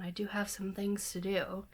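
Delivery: ordinary talk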